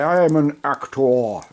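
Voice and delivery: slow, loud, hammed-up thespian; mock grand actor voice; over-the-top actor. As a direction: declare it like a pompous actor. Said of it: "over the top".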